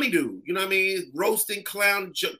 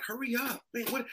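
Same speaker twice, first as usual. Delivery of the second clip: soft, higher impatient aside; 5 semitones higher, 9 dB quieter than usual. Retold or imitated. Comic waiting on another act's set, quietly impatient. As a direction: urge them along under your voice. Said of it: "So I'm always like".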